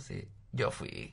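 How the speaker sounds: hushed, slow whisper; whispered confession. Retold or imitated. Son finally confessing to his father, whispered. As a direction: confess it under your breath. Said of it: "le dije".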